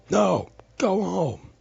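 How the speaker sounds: high, drawn-out loud yell; shouted command. A narrator's reading. Narrator yells a command back.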